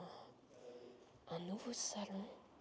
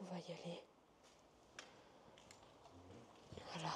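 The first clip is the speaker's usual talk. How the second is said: nervous night vlog whisper; 6 dB quieter than usual. Real, not acted. Unstable kitchen, back to whisper.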